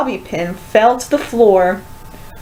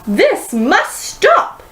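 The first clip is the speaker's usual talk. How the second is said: teacher shouting; 7 semitones higher, slower than usual. A narrator's reading. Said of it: "shouted Miss Trisk".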